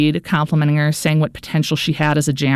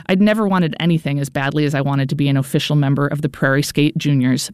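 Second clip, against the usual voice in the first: plain reading voice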